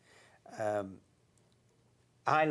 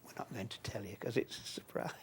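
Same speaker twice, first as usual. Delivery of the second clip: conspiratorial whisper to camera; whispering the joke straight to the camera; 5 semitones lower, 9 dB quieter than usual. Retold or imitated. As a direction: hush it like a secret. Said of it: "and said".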